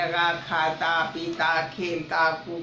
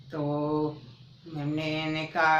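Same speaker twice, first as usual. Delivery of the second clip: plain reading voice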